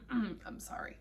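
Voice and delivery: a whispered, tearful I'm sorry; barely getting the words out through tears; choked apology. Real, not acted. Breaking down while telling of the diagnosis, a whispered sorry. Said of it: "the emotionalness of this".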